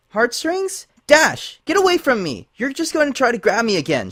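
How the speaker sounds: high, loud panicked outburst; terrified unicorn shrieking them away; high, loud, panicked cry; frightened cry. A narrator's reading. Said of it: "she cried".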